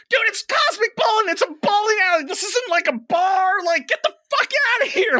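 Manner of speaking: very high-pitched, worked-up rant; retold angry tirade. Bowler telling off a man at cosmic bowling, furious re-enactment. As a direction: re-enact going off on someone, incredulous and heated. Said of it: "I just went off on this guy".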